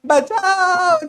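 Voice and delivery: loud, high, long-drawn shout; drowning cry for help. Acted. Teacher acts himself drowning, surfacing to yell, long high shout. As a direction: shout it out, stretching the last vowel. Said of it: "चिल्लाता है".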